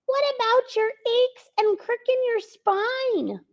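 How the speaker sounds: gnomes fretting over Santa; worried gnomes. Acted. Gnomes continue worrying.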